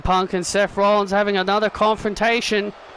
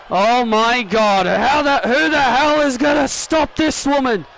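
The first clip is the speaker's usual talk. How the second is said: raised, excited reaction; astonished commentary. Pretend. Commentator reacts to Nia's dominant win, excited astonishment. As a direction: marvel at the unstoppable winner.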